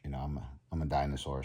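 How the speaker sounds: usual speaking voice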